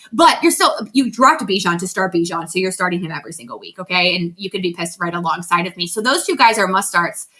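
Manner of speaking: normal talking voice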